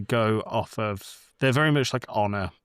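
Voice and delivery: ordinary talk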